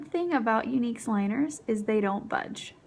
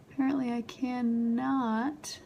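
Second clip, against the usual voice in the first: usual speaking voice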